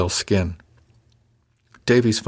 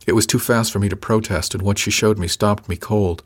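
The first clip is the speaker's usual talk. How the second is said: ordinary delivery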